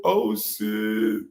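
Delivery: ordinary talk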